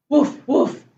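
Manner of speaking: short imitation woofs; quick, playful mock barking; puppy bark. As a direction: bark like a little dog. Story reader barks as puppy Biscuit.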